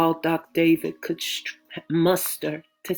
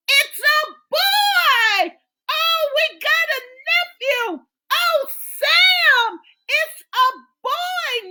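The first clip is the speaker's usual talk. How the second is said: joyful shout; 6 dB louder than usual. Acted. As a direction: cheer the news out. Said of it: "Naomi shouted with joy".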